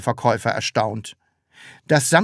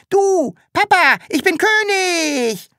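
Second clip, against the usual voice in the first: very high, slow thrilled cry; excited shout; 6 dB louder, slower than usual. A narrator's reading. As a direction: shout it in delight. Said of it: "schrie das Sams begeistert".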